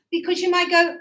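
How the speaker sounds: ordinary delivery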